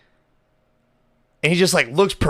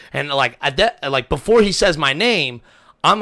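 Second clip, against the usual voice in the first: usual speaking voice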